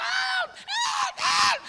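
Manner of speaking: high, loud cries for help; desperate scream. Retold or imitated. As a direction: scream it like you're drowning. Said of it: "sisigaw ka ng hanggat kaya". (malakas at mataas na sigaw ng saklolo)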